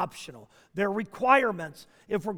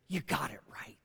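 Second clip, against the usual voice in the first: whisper; 8 semitones lower, 10 dB quieter than usual. Acted. Jesus imagined saying it.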